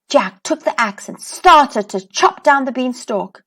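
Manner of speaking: plain reading voice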